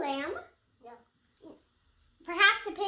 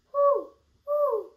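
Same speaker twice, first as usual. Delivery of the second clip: high, loud owl hoot; 9 semitones higher, 6 dB louder than usual. Shown as a demonstration. Reviewing book sounds for the child, owl hoot. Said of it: "let's review".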